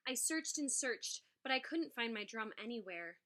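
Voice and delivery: plain reading voice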